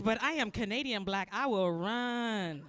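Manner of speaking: ordinary talk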